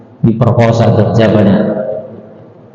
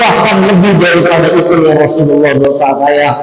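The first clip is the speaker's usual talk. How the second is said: higher, anguished admission; despairing youth; 6 semitones higher than usual. Acted. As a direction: insist your sin is bigger. (pemuda putus asa, suara tinggi)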